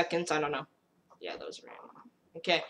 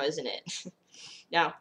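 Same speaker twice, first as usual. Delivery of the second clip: ordinary talk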